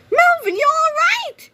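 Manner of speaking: high, slow anxious mother's cry; worried cry. A narrator's reading. Mother rushing to her son, high anxious cry. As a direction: call out to her son in alarm. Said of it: "cried Missus Needley".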